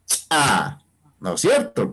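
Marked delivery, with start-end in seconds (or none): 0.1-0.8 "Cha" with a sharp popped ch, a tiny gap, then the vowel; popped ejective ch. Shown as a demonstration. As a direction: pop the ch of "Cha" apart from the a. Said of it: "¿Cómo se pronuncia?".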